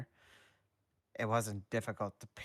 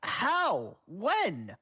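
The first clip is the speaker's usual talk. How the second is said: very high, loud startled questions; shocked disbelief; 12 semitones higher, 7 dB louder than usual. Acted. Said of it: "Danny wondered".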